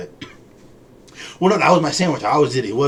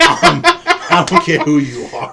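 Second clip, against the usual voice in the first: high, loud words through laughter; laughing mock threat; 9 dB louder, slower than usual. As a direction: crack up while acting tough.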